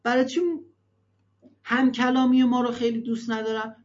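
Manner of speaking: usual speaking voice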